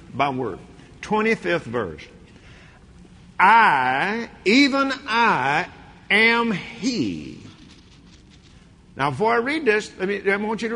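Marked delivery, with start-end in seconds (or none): 3.4-7.4 raised, slow scripture reading; solemn preacher reading; preaching. Acted. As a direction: proclaim each word with weight. Reads scripture slowly with weight.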